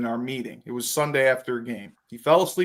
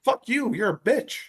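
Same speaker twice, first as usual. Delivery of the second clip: angry; 6 semitones higher than usual. Retold or imitated. Linemen cursing at teammate.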